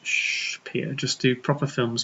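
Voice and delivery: very soft hushed coaxing; mock soothing. Coaxing Peter to do proper films, hushed mock soothing. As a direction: shush him, then advise very softly. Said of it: "hug him and just go".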